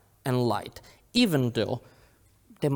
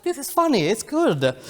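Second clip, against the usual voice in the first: much higher, louder, eager defence of the joke; retold self, excited; 11 semitones higher, 4 dB louder than usual. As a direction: insist it's funny like a proud kid. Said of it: "I was like".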